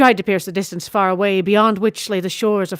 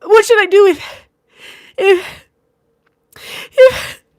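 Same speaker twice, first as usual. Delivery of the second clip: high, loud, breaking into sobbing pleas; choked sobs; 12 semitones higher, 9 dB louder, slower than usual. A narrator's reading. Sister breaking down as brother leaves, sobbing. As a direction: let the words fall apart in tears. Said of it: "Her voice was choked in sobs".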